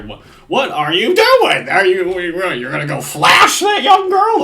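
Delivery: loud, high, slow mock alarm; mock outraged shout. Commentator parodying the show's big deal, mock outraged shout. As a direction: blow it up into exaggerated scandalised outrage.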